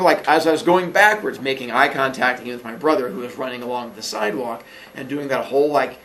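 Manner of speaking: ordinary delivery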